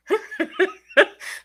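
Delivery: sudden high-pitched laugh. Real, not acted. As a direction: burst out laughing. Laughs at viewer comment.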